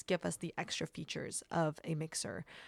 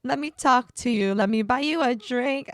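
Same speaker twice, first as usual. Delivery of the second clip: auto-tune voice; 4 semitones higher, 7 dB louder, slower than usual. Shown as a demonstration.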